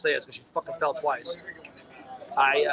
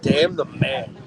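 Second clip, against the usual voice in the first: louder, low-pitched declaration; emphatic repeat; 4 semitones lower, 5 dB louder, much slower than usual. Real, not acted. Repeating it after the co-host says huh, emphatic repeat. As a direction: repeat it firmly for someone who missed it.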